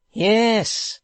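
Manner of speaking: loud high yes; defiant yes. Acted. Hugh answering the hangman, loud defiant yes. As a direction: fling it back.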